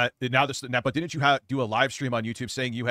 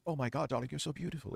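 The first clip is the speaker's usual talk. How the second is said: soft, badly faked British accent; hushed mock-British sweet talk; 3 semitones higher, 11 dB quieter than usual. Imitating a woman luring young girls, bad British accent. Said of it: "clear, concise British accent coming".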